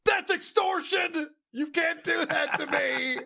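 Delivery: high, slow, indignant mock cry; mocking whiny outburst. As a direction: squeal it like an outraged whiner. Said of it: "And John went".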